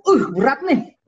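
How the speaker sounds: loud, high groaning complaint; participant's complaint. Acted. Participant grumbling to others during an activity, exaggerated complaint.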